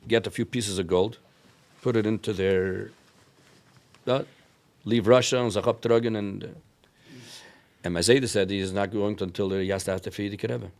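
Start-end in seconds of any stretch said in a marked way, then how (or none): none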